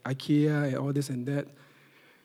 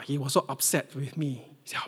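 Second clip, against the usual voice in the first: ordinary delivery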